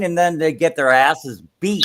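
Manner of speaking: ordinary delivery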